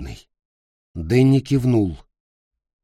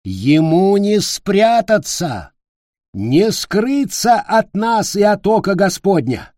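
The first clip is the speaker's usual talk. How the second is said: fiery preacher; 9 semitones higher, 4 dB louder, slower than usual. A narrator's reading. Preacher rousing the crowd.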